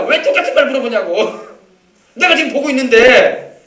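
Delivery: exasperated, high, loud protest; worked-up annoyance. Acted. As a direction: act out a man fed up with being asked. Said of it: "짜증을 내는 거야".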